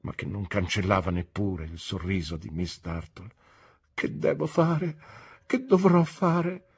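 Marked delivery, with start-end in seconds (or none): 3.9-6.6 high, slow and breaking; despairing plea. A narrator's reading. Emily begs Rosa what to do, despairing. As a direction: ask helplessly in anguish.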